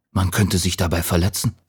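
plain reading voice

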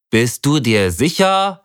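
slow, doubtful question; suspicious. A narrator's reading. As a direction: question him with distrust. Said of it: "fragte Connor misstrauisch".

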